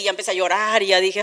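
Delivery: ordinary delivery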